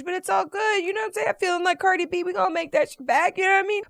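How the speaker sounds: high, breezy bragging; playful swagger. Retold or imitated. Feeling like [name] about spending, high breezy swagger. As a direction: swagger like a rapper flexing.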